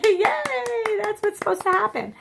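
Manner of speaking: put-on high, bright little-kid cheer; happy child. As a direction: jump up cheering like a proud toddler. Child cheering after falling.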